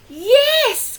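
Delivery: high, loud eager yes; cried yes. Acted. Witch welcoming the bird, a high loud cry. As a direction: burst out with a happy yes. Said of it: "cried the witch".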